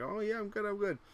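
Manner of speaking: imitating wife. Wife voiced with high voice.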